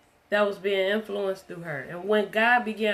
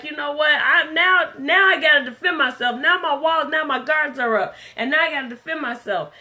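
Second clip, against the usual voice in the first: mock heated defensive rant, louder and higher; 5 semitones higher, 6 dB louder than usual. Preacher voicing a heated argument, a loud defensive mock rant. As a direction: act out someone whose guard is up and who is firing back loudly. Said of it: "then we'll feel like".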